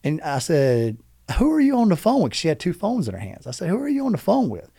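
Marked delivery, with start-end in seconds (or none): 1.4-2.3 high-pitched, baffled questioning; retold incredulous demand. Demands answer from wife. 3.7-4.7 high-pitched, baffled questioning; retold incredulous demand. Repeats demand to wife.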